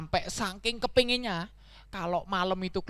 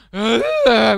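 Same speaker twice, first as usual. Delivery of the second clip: loud awful bray; braying stab at singing; donkey singing attempt; 12 dB louder than usual. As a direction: belt one terrible blaring note. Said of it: "Sekarang coba nyanyi".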